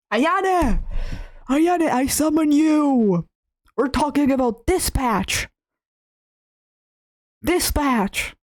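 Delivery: drawn-out summoning call. Real, not acted. Summoning girlfriend, calling out.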